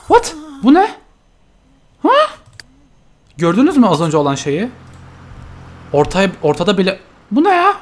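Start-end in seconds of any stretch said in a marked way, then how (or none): 0.1-2.1 much higher and louder; startled cry at the screen; startled shriek. Real, not acted. Sudden creature appears. 7.3-7.8 much higher and louder; startled cry at the screen; startled shriek. Real, not acted. Creature appears.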